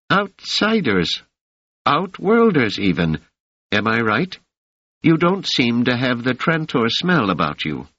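put-on high, gentle old woman's welcome. A narrator's reading. Mother Rita speaks.

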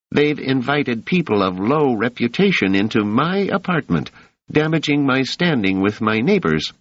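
a piercing, indignant landlady jabbing at her tenants; shrill landlady. A narrator's reading. Landlady denouncing Doris to the officer, shrill and high. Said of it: "interposed in a shrill voice".

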